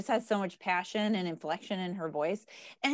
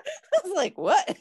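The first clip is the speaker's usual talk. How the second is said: high-pitched, laughing disbelief; retold puzzlement, pitched way up and louder; mock bafflement; 13 semitones higher, 4 dB louder, slower than usual. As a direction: squeal it in amused confusion at yourself. Laughing at own unknown-word moment.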